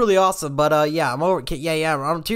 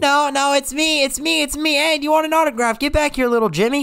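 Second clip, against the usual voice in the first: high, eager clamoring; clamor for attention in a high voice; excited boasting; 11 semitones higher, 3 dB louder than usual. Real, not acted. YouTuber claims a fan as his own, excited boasting.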